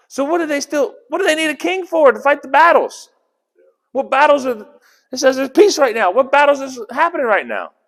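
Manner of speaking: high, loud, pressing questions; preacher at full force demanding what battles; fervent preaching. Acted. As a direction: hammer the point home to the room.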